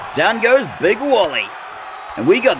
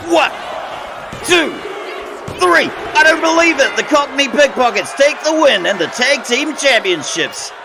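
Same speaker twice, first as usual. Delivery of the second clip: stunned excited call; 6 semitones higher than usual. Real, not acted. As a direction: call the upset win at full voice. Upset win called live.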